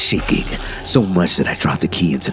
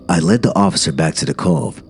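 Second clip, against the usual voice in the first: ordinary delivery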